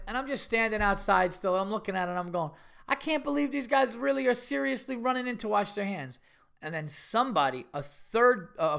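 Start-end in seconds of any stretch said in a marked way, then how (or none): 2.9-6.2 high-pitched incredulous complaint; retold disbelief. Comedian left outside as friends go wash, incredulous. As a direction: marvel at it in a raised voice.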